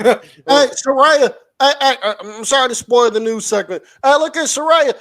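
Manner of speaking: loud mock call-out. Host calling out to a departing wrestler, loud and mocking. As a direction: shout her name across the room.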